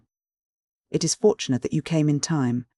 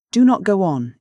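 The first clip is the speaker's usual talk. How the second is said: sharp, louder command cutting him off; imperious; 5 semitones higher, 6 dB louder than usual. A narrator's reading. Countess cuts off her father, imperious and loud. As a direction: stop him cold with authority. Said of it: "interrupted the Countess imperiously".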